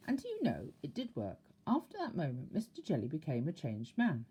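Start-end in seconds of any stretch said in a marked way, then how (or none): none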